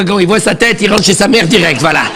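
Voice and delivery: ordinary delivery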